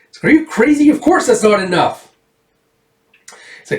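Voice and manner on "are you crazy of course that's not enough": high, loud incredulous retort; outraged little-girl protest; indignant girl. Acted. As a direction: scoff at the offer in a child's pitch. Erica rejects his offer sharply.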